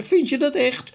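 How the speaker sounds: can't quite believe the praise; eager disbelief. A narrator's reading. The giant asks Sophie eagerly, high and hopeful. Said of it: "wiens gezicht meteen opklaarde".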